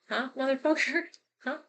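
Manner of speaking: high-pitched, joking indignation with a laugh; mock challenge. To the relative grandma favoured, mock challenge. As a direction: needle them playfully, pitch up.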